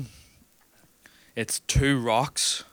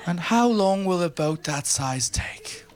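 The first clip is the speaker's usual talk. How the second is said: breathy, much higher, slow posh English question; hushed mock toff asking politely; posh English, whispered; 8 semitones higher, slower than usual. English customers asking shipbuilders about timing, whispered posh English. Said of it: "English people ... were like".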